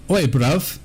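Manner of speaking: jokey British accent put on for a streaming partner; mock British accent. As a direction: overplay a cheeky British accent. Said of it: "I'm trying to do British".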